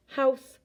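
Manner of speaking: house said loud and high, each sound clear; over enunciating. Shown as a demonstration. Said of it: "I say it slowly".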